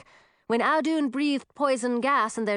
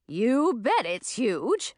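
put-on old witch voice; 3 semitones higher, much slower than usual.

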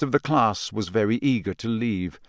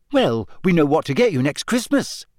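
cheerful lift to cheer him up; perky, upbeat teasing; bright; 5 semitones higher than usual. A narrator's reading. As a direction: Hermione cheering up gloomy Ron, brightly. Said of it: "said Hermione brightly".

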